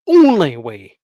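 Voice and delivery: "only" very loud and stretched, falling away; insistent repeat. Real, not acted. Vlogger insisting on the point about travel time, emphatic. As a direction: hammer "only" and let it fall. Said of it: "and I mean the".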